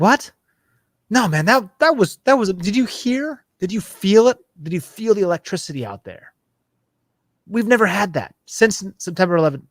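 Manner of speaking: very high, loud, breathless disbelief; can't believe you missed the magic, push it up high and loud; excited physio. Retold or imitated. Physio rebutting the skater's disappointment, high loud excitement. Physio insists race was incredible.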